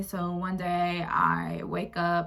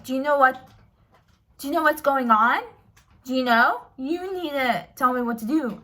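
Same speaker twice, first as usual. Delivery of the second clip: mock angry aunt; 4 semitones higher, 5 dB louder than usual. Angry aunt demanding on phone.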